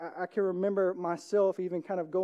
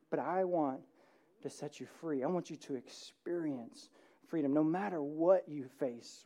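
tender voice of God; 4 semitones lower, 7 dB quieter than usual. Acted. God's voice, tender.

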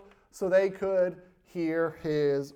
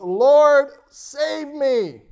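higher, louder panicked cry; desperate cry; 8 semitones higher, 9 dB louder, much slower than usual. Acted. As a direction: shout for rescue while sinking. Sinking Peter cries out.

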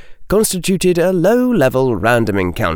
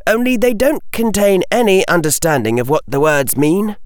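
lightly voiced boy, pitched higher; a hint of an earnest, higher boy's voice; young Harry; 7 semitones higher than usual. A narrator's reading. Harry explaining to a witch, lightly voiced higher boy. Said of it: "Harry said".

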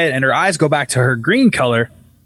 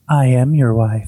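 lower, eerie imitation; possessed wife; 4 semitones lower than usual. Possessed wife answering the husband, eerie and lowered. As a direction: drop the voice for a creepy possessed line. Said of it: "she just goes".

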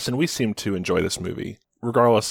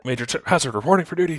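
eager soldier reporting in; 8 semitones higher, much faster than usual. Acted. Answering soldier bit.

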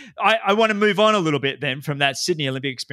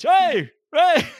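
much higher, playful call; imitating shouting; 14 semitones higher, 4 dB louder than usual. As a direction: yell it like a teammate in the water. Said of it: "I'd hear this".

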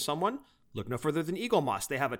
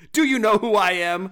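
loud indignant demand; huffy, self-important customer shouting; entitled customer; 5 semitones higher, 8 dB louder than usual. Pretend.

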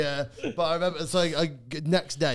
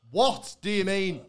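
higher, slow mock-drunk question; drunk Jamie; 6 semitones higher, slower than usual. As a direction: stretch it out in tipsy bafflement. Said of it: "you've experienced Jamie Pist".

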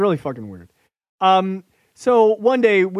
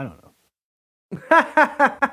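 slow, high laughing drawl; 7 semitones higher than usual. Real, not acted. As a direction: stretch it out while laughing. Laughing drawn-out aside.

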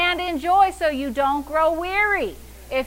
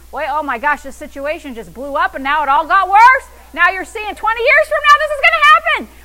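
mock panic, building louder and much higher; thoughts running wild; 3 semitones higher, 8 dB louder, faster than usual. Worrier imagining the situation getting worse, mock panic rising. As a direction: spiral into hammed-up alarm. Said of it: "allowed your thoughts to run wild".

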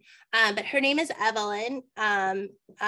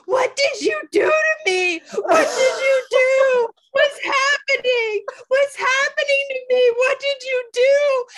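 retold shouting through tears, much higher and louder; crying shout; 9 dB louder than usual. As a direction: cry out in panic, half sobbing. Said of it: "I start hysterically crying".

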